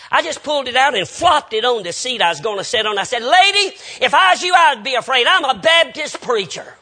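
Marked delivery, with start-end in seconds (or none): 3.2-6.8 high, booming preacher's comeback; loud retort. Retold or imitated. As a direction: throw her warning back for everyone to hear. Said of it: "I said it so loud everybody could hear".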